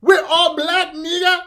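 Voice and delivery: put-on high, loud indignant Nigerian accent; hammed-up, outraged West African accent, high-pitched; mock Nigerian accent. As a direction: protest in an exaggerated Nigerian accent. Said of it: "Mister Nigerian".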